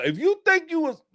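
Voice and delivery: high-pitched indignant acting-out; defiant challenge. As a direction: start a challenge in a high, incredulous voice.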